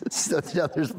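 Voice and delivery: normal talking voice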